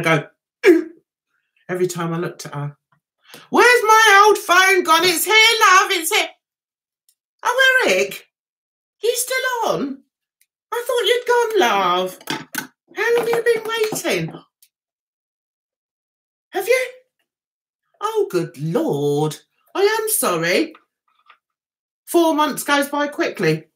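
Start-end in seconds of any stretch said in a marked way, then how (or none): 3.5-6.3 higher, louder call to mum. Real, not acted. Asking mum about the phone, raised calling voice. 7.4-20.7 much higher, apologetic surprise; surprised. Real, not acted. Finding Eric still on the phone, high surprised voice.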